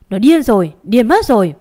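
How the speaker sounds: loud, indignant cry; outraged exclamation. A narrator's reading. Grandmother slamming the table at the confession, outraged. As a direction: burst out in outrage.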